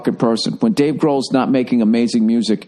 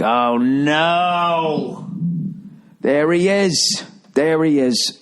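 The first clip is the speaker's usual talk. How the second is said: mock dread, drawn out and higher; 5 semitones higher, much slower than usual. At a party, seeing the dreaded guy arrive, mock dread. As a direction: groan it like you spot someone awful. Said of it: "social events you're like".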